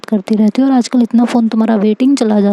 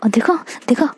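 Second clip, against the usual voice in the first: hissed under the breath, softer; sharp whisper; 3 semitones higher, 6 dB quieter than usual. Acted. Isha scolds Anvi in a sharp whisper.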